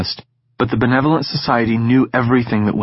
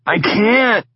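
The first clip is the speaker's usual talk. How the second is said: groaning in pain; 13 semitones higher than usual. Acted. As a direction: groan it out in agony. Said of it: "he groaned".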